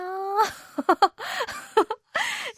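high bashful giggle; shy laugh. Real, not acted. Host recalls her own young crush, laughing shyly. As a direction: laugh it off sheepishly.

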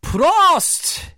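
high, loud "Prost" called out; mock toast shout. Host leading listeners in a toast, loud cheer. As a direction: raise a glass and yell it. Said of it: "is everyone ready to say".

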